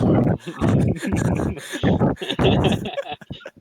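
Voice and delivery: big high-pitched laughing fit; loud laughing burst. Real, not acted. Player cracking up at a table joke, loud laughter.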